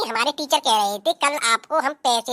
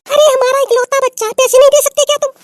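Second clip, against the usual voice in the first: high, much louder angry demand; 11 semitones higher, 9 dB louder than usual. Acted. Wife scolding stingy husband for their only child, loud. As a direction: tell him off loudly.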